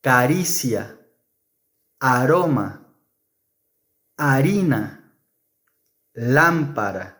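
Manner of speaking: caricia, aroma, harina, lámpara with a single tapped r; soft r. Shown as a demonstration. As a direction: tap the r once in each word. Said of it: "Spanish R word list".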